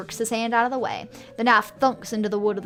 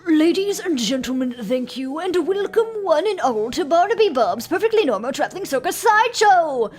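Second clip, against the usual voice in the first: loud, higher circus showman; ringmaster voice; 5 semitones higher, 5 dB louder than usual. Acted. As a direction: announce it like a big-top ringmaster. Ringmaster greets crowd.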